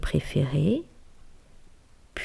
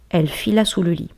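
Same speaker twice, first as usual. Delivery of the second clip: usual speaking voice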